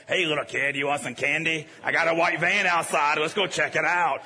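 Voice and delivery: lightly voiced creepy candy-offering stranger; suspicious stranger. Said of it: "sounded like".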